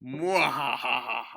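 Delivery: deep, slow evil cackle; mad scientist laugh. Acted. Villain laughs in commercial.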